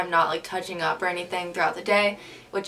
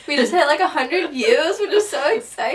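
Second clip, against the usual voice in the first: excited; 7 semitones higher, 7 dB louder than usual. Real, not acted. Celebrating a hundred views.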